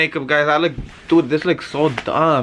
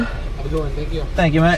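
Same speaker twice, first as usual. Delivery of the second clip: quick mock British thanks; amateur British; attempted British accent; 2 semitones lower than usual. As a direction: sign off in a badly faked British accent. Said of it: "That was my attempt".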